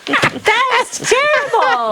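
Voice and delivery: laughing. Real, not acted. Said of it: "co-host groans at the joke".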